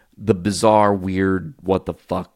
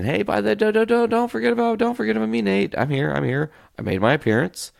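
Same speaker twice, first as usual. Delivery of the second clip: much higher voice playing the train's horn; talking train horn; faster than usual. Telling listeners about the train horn, high squeaky playful voice. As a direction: squeak it out like a horn begging to be noticed. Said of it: "Train of our existence showing up saying".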